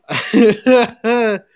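high loud burst of laughter; laughing. Real, not acted. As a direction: crack up mid-bit. Breaks up laughing.

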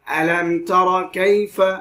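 "আলাম তার কাইফা" slow, with a short clean m; don't hum the m in "আলাম তার কাইফা"; clear meem, no ghunna. Shown as a demonstration. Quran teacher showing izhar, clear without ghunna. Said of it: "গুণনা ছাড়া".

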